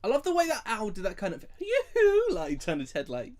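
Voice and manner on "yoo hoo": high, loud hooting owl call. Retold or imitated. Imitating the owl in the film, hooting. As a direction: hoot it like the owl.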